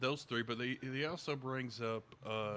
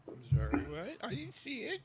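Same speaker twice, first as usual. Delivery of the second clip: mock bafflement, the last words pitched way up; sarcastic play dumb; 12 semitones higher, 5 dB louder than usual. Mocking deniers ignoring the evidence, high mock confusion. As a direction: pretend you can't see what's right in front of you.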